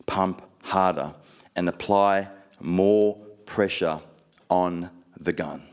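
slow, calm, low divine instruction; voice of the Holy Spirit. Retold or imitated. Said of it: "the Holy Spirit said to me".

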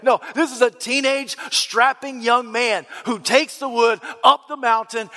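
usual speaking voice

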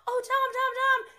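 high, loud excited fan squeal; mock fan calling out. Fans screaming at Tom in the crowd, high mock squeal. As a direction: call his name like a starstruck fan.

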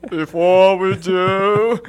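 loud, very slow, drawn-out announcement; silly put-on voice. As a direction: stretch each word in a mock grand voice. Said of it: "The man with the voice".

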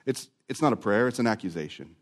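ordinary delivery

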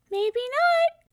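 very high, loud little mouse squeak; tiny voice. A narrator's reading. Unseen mouse answers the disappointed young man in a tiny voice. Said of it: "came a tiny voice".

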